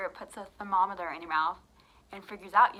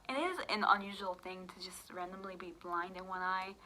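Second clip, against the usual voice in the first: normal talking voice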